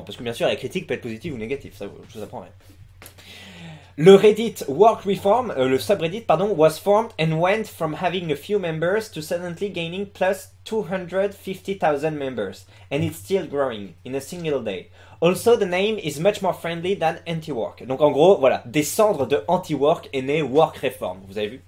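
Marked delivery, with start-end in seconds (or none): 4.0-5.5 slow, deliberate English with a thick French accent; basic French accent. Shown as a demonstration. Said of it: "je tente l'accent français de base". 6.6-17.9 English read with a thick French accent; basic French accent. Shown as a demonstration. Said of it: "je tente l'accent français de base".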